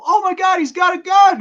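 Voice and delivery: high, loud alarmed yelp; panicked movie quote. Retold or imitated. Retelling a movie scene, quoting the panicked bystander loudly. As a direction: shriek it like a scared bystander. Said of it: "they, they go".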